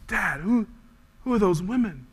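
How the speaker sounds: hint of a child's voice; little boy's voice. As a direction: ask like a curious young son. Little boy asks his dad.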